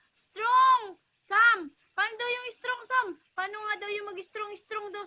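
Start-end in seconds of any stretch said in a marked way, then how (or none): 0.1-1.0 Strong! shouted with a falling punch; loud cheer. Shown as a demonstration. Mother shouting Strong! to show her son the pose. 1.2-3.1 loud coaxing call. Real, not acted. Mother loudly coaxing her son to flex.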